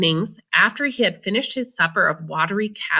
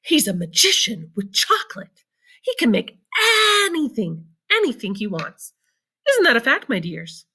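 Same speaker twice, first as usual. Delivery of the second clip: put-on excited old man; 5 semitones higher than usual. A narrator's reading. Grandpa Joe boasting of Wonka to the family, excited. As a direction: boast about the magician. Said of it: "cried the old man".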